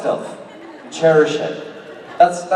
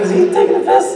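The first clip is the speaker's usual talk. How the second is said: high, breathless through laughter; fan-panel story, cracking up at the prank; laughing disbelief; 3 dB louder than usual. Retold or imitated. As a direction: gasp it out between laughs. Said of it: "I was like".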